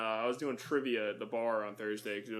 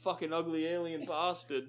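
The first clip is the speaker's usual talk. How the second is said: put-on British accent; 4 semitones higher, 4 dB quieter than usual. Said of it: "The British guy is like".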